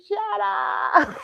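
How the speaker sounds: high-pitched retold wail; drunk arguing. Drunk girl outside arguing with her boyfriend, wailed protest. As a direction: whine it way up high.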